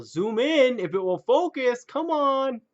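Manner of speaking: loud, high exasperated yell at the camera; exasperated, rising shout; frustrated shouting. Real, not acted. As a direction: holler at the gadget, pitch climbing. Frustrated shouting at webcam to focus.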